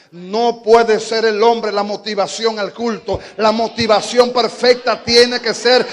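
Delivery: building to a loud preacher's shout; full-force preaching. Acted. Preacher building the congregation to a shout.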